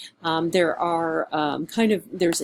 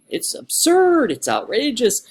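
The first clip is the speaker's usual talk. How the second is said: mock outrage; 4 semitones higher, 5 dB louder, slower than usual. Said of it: "So very opinionated".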